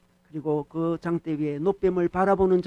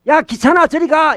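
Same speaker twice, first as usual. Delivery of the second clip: loud, high, irritated shooing; acted exasperated dismissal at full voice; annoyed brush-off; 9 semitones higher, 8 dB louder than usual. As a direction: shoo off a pest.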